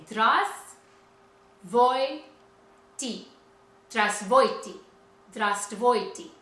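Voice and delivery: Здравствуйте very slow and loud, in separate pieces; syllable by syllable. Shown as a demonstration. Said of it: "that's a hard one".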